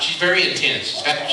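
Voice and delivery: normal talking voice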